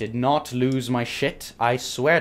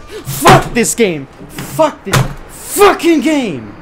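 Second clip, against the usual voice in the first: slow, high, furious shouting; raging at game; 11 semitones higher, 13 dB louder, much slower than usual. Real, not acted. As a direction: yell it in real rage, stretching each word.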